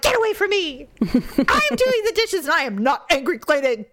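high-pitched, indignant snapping; hammed-up retold fury; furious. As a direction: snap it back with the voice jumping up. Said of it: "But I was furious".

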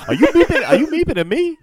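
hammy, laughing tough-guy challenge; De Niro impression. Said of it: "It kinda looks like a De Niro".

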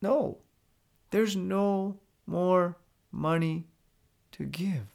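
a hard no, spaced out with pauses, falling on "give"; downward inflection. Shown as a demonstration. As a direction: state it slowly, closing the door on negotiation. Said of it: "once you use the downward inflection".

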